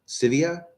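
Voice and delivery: "Sevilla" as SIV-ee-uh, rising, no L; silent double L. Real, not acted. Streamer unsure of viewer's name, "Sevilla" as SIV-ee-uh, rising. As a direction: try "Sevilla" as a question, SIV-ee-uh. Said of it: "the two L's, like, are silent".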